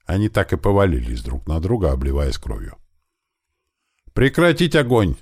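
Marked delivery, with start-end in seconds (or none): 4.2-5.2 high, urgent mild shout; shouted order. A narrator's reading. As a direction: cry out to halt everyone. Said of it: "закричал Туман". (громко крикнуть приказ)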